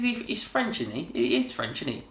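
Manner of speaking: ordinary talk, nothing marked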